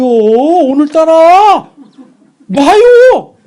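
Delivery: high, loud, slow yell to let go; angry shout. Retold or imitated. Son yelling at mother to let go of his leg. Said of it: "나를 붙잡고 안 놔주는".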